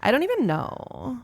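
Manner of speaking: put-on drawn-out creaky valley-girl fry; vocal fry. Shown as a demonstration. Podcaster demonstrating vocal fry, stretched creaky ending. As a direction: let the last word creak and stretch. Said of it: "vocal fry".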